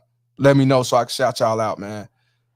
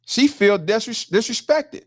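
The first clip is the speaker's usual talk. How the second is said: mocking quote; 8 semitones higher, slower than usual. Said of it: "She said".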